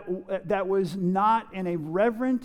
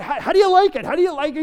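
high, loud imitation of someone fishing for praise; hammed-up eager asking; eager friend; 7 semitones higher, 7 dB louder, much faster than usual. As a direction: ask excitedly, pitched well up. Friend eagerly asks about haircut.

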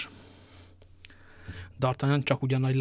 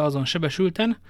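ordinary delivery

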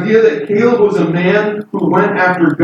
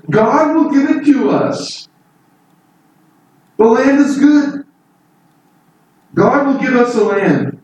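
higher, ringing with confidence; eager certainty; 8 semitones higher than usual. Retold or imitated. Caleb assuring the people God gives the land, eager. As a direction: proclaim it like a man sure of God. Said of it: "He says".

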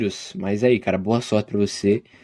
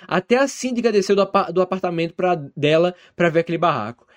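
higher-pitched, dramatic comic storytelling; animated story narration; 4 semitones higher than usual. A narrator's reading. Podcast host reading a listener's disaster story, animated narration. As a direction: read it out with lively emphasis.

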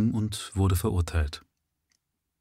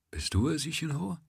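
soft, high, sleepy-quiet query; hushed question; 8 semitones higher, 7 dB quieter than usual. A narrator's reading. Governor in bed asks who has come, hushed. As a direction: ask it softly, pitch lifting. Governor roused at night by visitor.

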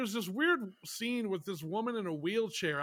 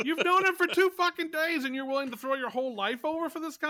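high, loud scolding disbelief; incredulous outburst; 8 semitones higher, 5 dB louder than usual. Acted. Podcaster scolding a film heroine, incredulous. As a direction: start at a yell, ease into exasperation.

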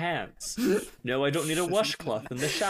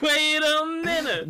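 excited exclaim; 14 semitones higher, 8 dB louder, slower than usual. Real, not acted. Joking about The Rock's bio.

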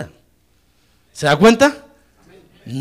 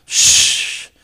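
loud voiceless "shh" noise; imitated whoosh; 5 dB louder than usual. Preacher imitating smoking ideas brought to church, loud whoosh. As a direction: push out a strong shushing hiss of air.

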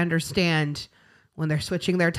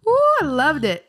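excited; 9 semitones higher, 5 dB louder than usual. Real, not acted. Gushing about the show.

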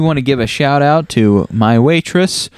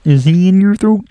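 Kermit-ish voice; 10 semitones higher than usual. Acted. Teasing about frog in throat.

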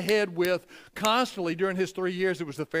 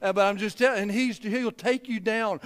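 ordinary talk

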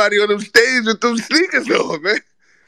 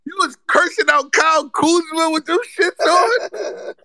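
mock outrage; 7 semitones higher than usual. Real, not acted. Roasting Ari's sneakers, outraged.

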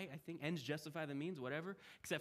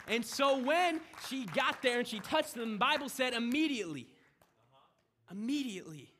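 raised, loud and slowed, hammering the point; preaching at full force; 6 semitones higher, 9 dB louder than usual. Acted. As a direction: build it up like a preacher at the climax. Preaching climax, loud and high.

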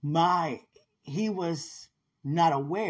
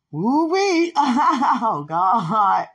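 loud, high whoop breaking into laughter; whoop, then laugh out the words; real overwhelmed exclamation; overjoyed outburst; 5 semitones higher, 9 dB louder, much slower than usual. Real, not acted. Overcome mid-message, exclaims and laughs.

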